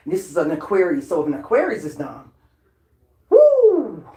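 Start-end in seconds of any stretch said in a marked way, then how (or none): 3.3-4.1 a sudden high, loud whoop; loud hype woo; excited whoop. Real, not acted. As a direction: let out a thrilled shriek. Excited outburst mid rant.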